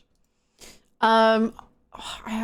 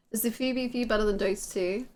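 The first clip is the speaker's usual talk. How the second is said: ordinary talk, nothing marked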